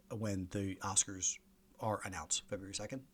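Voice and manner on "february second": quiet, softer "February second?" with a questioning rise on "second"; doubting question. Real, not acted. Host doubts his own date, quiet rising question.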